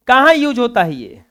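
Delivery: ordinary delivery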